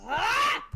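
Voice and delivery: short, high, loud yell; sharp attacking "Ah!"; battle cry. Acted. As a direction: burst out with a fierce cry. Player voices character's battle cry.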